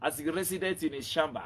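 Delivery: usual speaking voice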